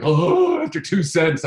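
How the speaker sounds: high, loud panicked yelp; mock panic. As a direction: mimic your old jumpy self crying out. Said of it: "I used to be like".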